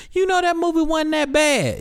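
very high put-on voice; mock moviegoer. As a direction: squeal it as a movie's defender. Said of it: "hop out the movie theater like".

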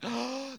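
mock-horrified gasp; sharp intake of breath, imitating appalled onlookers; shocked gasp. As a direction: gasp as if recoiling. Recoiling onlookers gasp in horror.

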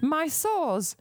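high, slow, complaining invalid; whiny invalid old lady. Pretend. Voicing invalid Gloria.

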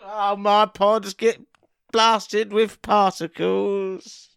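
slow, high, hammed-up wail of despair; mock lament. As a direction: drag it out like a tragic lament.

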